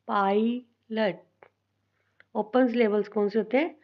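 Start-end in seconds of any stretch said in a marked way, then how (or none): 0.1-1.2 pilot in two slow parts, पाय, pause, लट; split into syllables. Shown as a demonstration. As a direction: break pilot apart with a clear gap. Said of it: "divide करते हैं".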